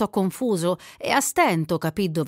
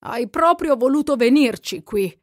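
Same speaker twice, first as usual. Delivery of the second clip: raised, loud altered voice; 6 semitones higher, 6 dB louder than usual. A narrator's reading. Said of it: "mugolando come se anche lui fosse mezzo addormentato".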